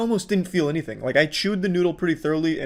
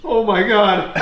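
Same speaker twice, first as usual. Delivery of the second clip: shocked gasp then pained laughter; 7 semitones higher, 7 dB louder, slower than usual. Real, not acted. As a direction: laugh through burning mouth pain. Eating painfully hot ramen.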